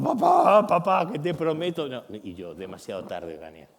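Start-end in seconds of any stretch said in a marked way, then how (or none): 0.0-1.9 much higher, louder wailing plea; a son begging his father through fake tears; a hammed-up tearful kid; mock crying plea. 2.5-3.7 lower, softer, unmoved reply; a dad who stays calm and does not give in; quiet, settled verdict; calm firm father. Retold or imitated. Father answers the crying son.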